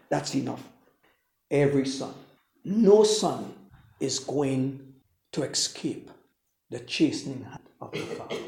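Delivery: ordinary delivery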